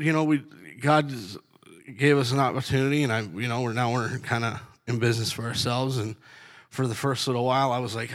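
usual speaking voice

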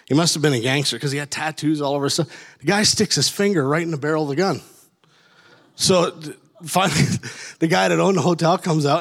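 usual speaking voice

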